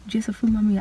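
a hushed, breathy retelling of the teacher; retold whisper. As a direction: whisper the teacher's line back.

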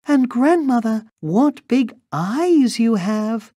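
put-on very high little-girl voice. A narrator's reading. Girl marvels at the disguised wolf, high and wondering. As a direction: pipe it up like a wondering child.

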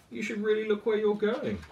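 a gentle but firm big animal warning; Moose voice. Acted. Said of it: "said Moose".

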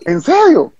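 high, stunned ¿En serio?; disbelief. Retold or imitated. Player reliving the call to the majors, stunned disbelief. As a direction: ask it like you can't believe the news. Told he's going to majors.

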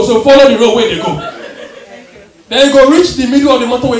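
loud, bossy street directions; loud Ghanaian direction giving. Retold or imitated. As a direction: bark the route out like a local.

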